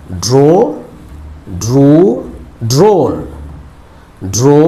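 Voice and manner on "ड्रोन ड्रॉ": usual speaking voice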